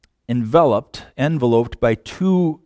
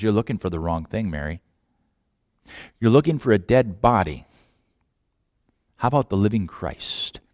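low and much softer; gentle, hushed; 7 semitones lower, 8 dB quieter, faster than usual. Acted. Jesus gently redirecting Mary, low and hushed. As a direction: correct her softly and low.

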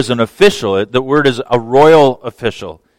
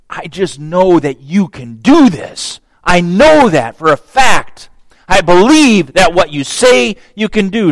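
insistent faith; 6 semitones higher, 5 dB louder than usual. Retold or imitated. Father insists on his faith.

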